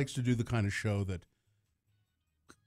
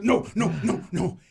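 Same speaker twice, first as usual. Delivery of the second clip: higher, louder acted refusals; panicked refusal; 6 semitones higher, 6 dB louder than usual. Father imitating his daughter refusing front seats, panicked.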